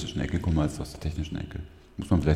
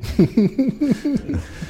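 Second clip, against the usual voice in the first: hohes Auflachen; 5 dB louder than usual. Real, not acted. Lacht über eigenen Fehltritt.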